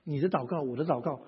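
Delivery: normal talking voice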